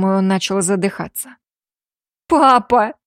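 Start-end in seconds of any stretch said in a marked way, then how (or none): 2.3-2.9 loud, high anguished call; desperate cry. Acted. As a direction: cry out in despair. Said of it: "с отчаянием воскликнула Лиза". (отчаянный крик)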